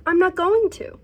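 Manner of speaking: high, loud and breezy cool; mock chirpy dismissal. Deciding to skip the book, a mock-cheerful high loud dismissal. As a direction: brightly wave the book off. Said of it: "And so I was like".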